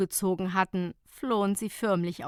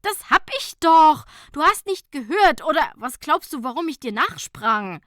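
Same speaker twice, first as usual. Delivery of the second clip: indignant reply; 7 semitones higher, 9 dB louder than usual. A narrator's reading. Quarrel in the car.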